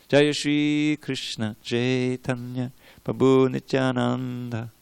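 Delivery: slow, careful "Jaya Shri Krishna Chaitanya Prabhu Nityananda" with Nitya-ananda drawn out; pronunciation drill. Shown as a demonstration. Teacher leading the nightly pronunciation drill, slow and careful. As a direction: chant each syllable slowly for the class to repeat. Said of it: "pronunciation drill".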